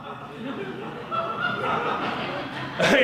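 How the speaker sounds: high-pitched, soft laugh; long incredulous laugh; disbelieving laugh. Acted. Reacts to absurd interrogation question.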